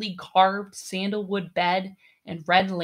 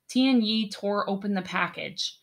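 ordinary delivery

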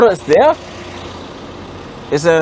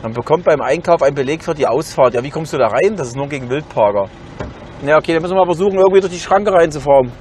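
ordinary talk

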